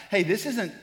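ordinary talk, nothing marked

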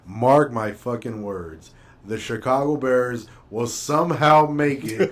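slow, drawn-out dramatic prediction; slow mock prophecy. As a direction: lay it down slowly like a prophecy. Joking bold Super Bowl prediction.

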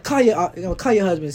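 high, loud, daring reply; defiant taunt. Retold or imitated. Taunting the mom who threatens to call her husband, defiant and loud. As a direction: dare her to do it.

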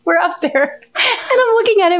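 high-pitched, giggly storytelling; laughing while talking. Real, not acted. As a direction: try to carry on through real laughter. Cracking up at her own story.

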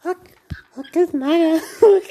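high silly Hulk voice; Hulk impression. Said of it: "Faz o Hulk".

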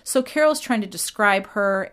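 ordinary talk

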